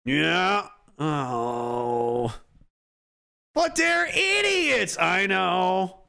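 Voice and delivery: hammed-up scornful dictator; Joseph Stalin. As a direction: sneer in disbelief like an old dictator. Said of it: "The ghost of Joseph Stalin is like".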